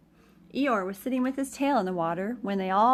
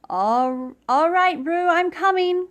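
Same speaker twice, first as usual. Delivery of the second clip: much louder call; louder urgent shout; 7 semitones higher, 11 dB louder, slower than usual. A narrator's reading. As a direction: shout across the water. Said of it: "cried Christopher Robin".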